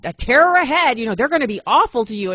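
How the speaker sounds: loud, high mock warning; mocking the lady. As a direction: ham up the gloomy stranger's doom. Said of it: "and she's saying".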